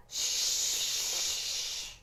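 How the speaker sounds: soft, long soothing shush; long quiet shh. Shown as a demonstration. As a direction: shush a crying baby gently. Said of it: "so let's give a demo".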